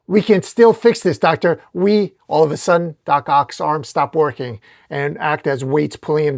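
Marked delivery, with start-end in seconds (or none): 0.0-2.1 urgent raised-voice plea; high, loud appeal, cut off mid-thought; Superman. Acted. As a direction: yell to talk an enemy round. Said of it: "Superman yelling at Doc Ock".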